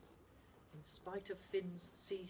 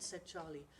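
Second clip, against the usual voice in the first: usual speaking voice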